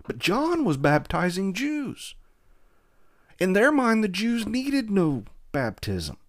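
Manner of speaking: impassioned preaching. Acted. Preacher building to climax.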